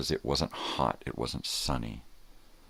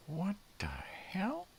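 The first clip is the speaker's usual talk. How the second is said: very high, slow squeaky dog voice; a baffled dog, drawn out and high; dog's voice; much slower than usual. Retold or imitated. Dog sees him naked, slow high squeaky confusion. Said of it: "And he's like".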